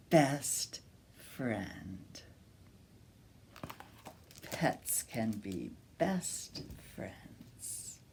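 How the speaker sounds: soft slow closing whisper; gentle bedtime hush; tender whisper. Acted. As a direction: whisper the ending lovingly. Tender ending of story.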